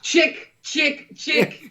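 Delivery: high, laughing mock Russian voice; laughing Russian impression; mock Russian accent. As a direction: repeat it like a hammy Russian villain. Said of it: "Teddy K G B".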